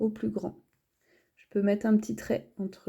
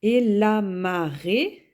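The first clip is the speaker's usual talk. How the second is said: "Et la marée" said slowly and louder; slow dictation; 4 dB louder, slower than usual. Shown as a demonstration. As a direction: dictate "la marée" for the class to write. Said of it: "dictating then repeating the word".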